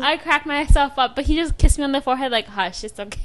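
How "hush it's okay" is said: softer, gentle shushing; soothing hush. Retold or imitated. Kissing her forehead to calm her, hushed and gentle. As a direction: comfort her softly.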